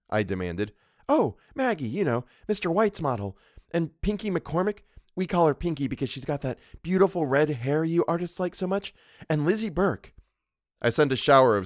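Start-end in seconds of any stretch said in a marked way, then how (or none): 1.1-10.1 put-on high, girlish, breezy chatter; chattering young model. A narrator's reading. Model listing her companions for the painter, chattering. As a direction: reel off your friends gossipily. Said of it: "she began to chatter".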